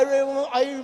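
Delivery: ordinary delivery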